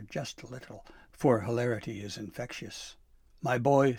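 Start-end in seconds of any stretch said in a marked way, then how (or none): 3.4-4.0 loud, mild chortle in the voice; chortling. A narrator's reading. Santa to his favorite elf after laughing, mild chortle. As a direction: say it as if getting over laughter. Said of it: "Santa chortled at last".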